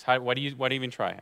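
ordinary delivery

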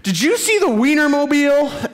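put-on high, eager little-kid voice; excited kid. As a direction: play an eight-year-old bursting to ask. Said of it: "He says".

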